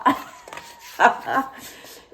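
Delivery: breathy burst of laughter; self-mocking laugh. Real, not acted. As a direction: laugh at your own muddle. Laughs at getting muddled. (éclat de rire gêné)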